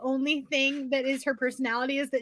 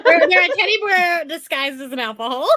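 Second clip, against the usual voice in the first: excited; 6 semitones higher, 8 dB louder than usual. Real, not acted. Lively reading banter, punchline.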